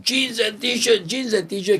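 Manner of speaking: high, drawn-out market cry; imitating rival hawkers shouting; hawker's call. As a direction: call out wares like a street hawker. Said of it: "when they were shouting".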